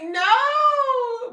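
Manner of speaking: high, loud, drawn-out mock cry; hammed-up shriek of protest; dismayed wail. Daughter answering mom about ruined hair, wailing.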